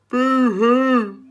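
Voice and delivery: high, very loud comic boo-hoo; lion boo-hoo wail. A narrator's reading. Cowardly lion wailing to the friends, loud and high. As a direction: wail like a big scared cat. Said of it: "cried the lion".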